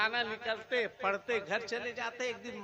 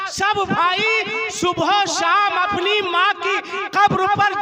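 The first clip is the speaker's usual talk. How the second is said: loud, higher fiery sermon scolding the crowd; preaching at full force; 8 semitones higher, 15 dB louder than usual. Real, not acted. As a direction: thunder at the congregation about honouring mothers.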